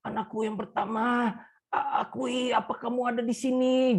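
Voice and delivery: put-on a feeble old father calling for his eldest; the dying man searching for his son; weak dying voice. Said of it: "Terus dia tanya".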